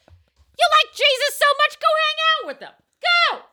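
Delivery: hammed-up scorned columnist; mock jealous rant. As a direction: throw a jealous hissy fit. Mock jealous Ann Landers rant.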